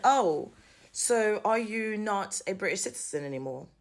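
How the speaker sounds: normal talking voice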